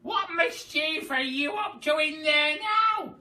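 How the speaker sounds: put-on high, loud old-woman screech; Grandma screeching at George. A narrator's reading. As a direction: shriek it accusingly through the wall. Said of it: "she screeched".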